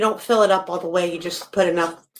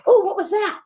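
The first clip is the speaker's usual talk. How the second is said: much higher, wide-eyed puppy voice; startled puppy voice; 10 semitones higher than usual. Retold or imitated. Puppy hearing the rattle can land nearby, high startled voice. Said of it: "so they go".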